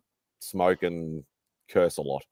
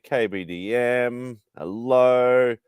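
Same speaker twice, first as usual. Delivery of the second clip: usual speaking voice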